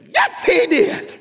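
very high, loud drawn-out outburst; irrepressible shout. Acted. Old church mother can't help answering, high shout. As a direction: burst out in a high acted shriek. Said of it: "couldn't help it".

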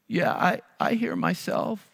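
put-on little kid voice. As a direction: answer like a frightened little boy. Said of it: "I was talking like a little kid".

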